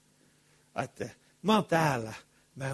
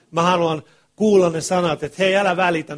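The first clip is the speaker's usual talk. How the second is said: impassioned cry; 4 semitones higher, 11 dB louder than usual. Real, not acted. Preacher's impassioned plea.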